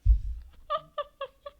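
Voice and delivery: high, staccato giggling; high laugh. Real, not acted. Streamer cracks up over the spoon-like penguin, high laugh. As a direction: giggle high in short bursts.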